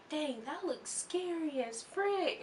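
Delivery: high, slow, soft mock awe; star impression. Bozzy looking up at the stage, high and hushed. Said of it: "and he's like".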